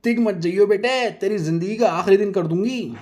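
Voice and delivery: loud high yelled threat; shouting. Acted. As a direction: yell after the fleeing man. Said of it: "Nazia चिल्लाकर कहती है".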